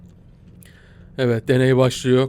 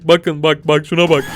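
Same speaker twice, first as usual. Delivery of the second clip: usual speaking voice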